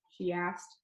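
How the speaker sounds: plain reading voice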